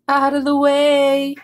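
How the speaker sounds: shout. A narrator's reading. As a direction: shout slowly to clear a path. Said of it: "he shouted".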